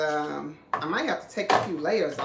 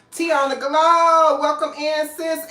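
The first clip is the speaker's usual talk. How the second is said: high, loud drawn-out shout-out; big whooping greeting to a sister; excited welcome; 8 semitones higher, 9 dB louder, slower than usual. Real, not acted. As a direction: holler a friend's name, stretched out with joy. Hailing a friend who joined.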